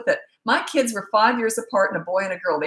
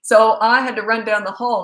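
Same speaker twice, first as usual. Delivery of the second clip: ordinary delivery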